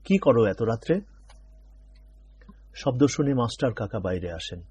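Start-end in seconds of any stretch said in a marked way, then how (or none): 0.0-1.0 louder stern rebuke; scolding. A narrator's reading. Father scolding children at night, louder. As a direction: bark them back to bed. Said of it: "বাবা ধমকে উঠলেন".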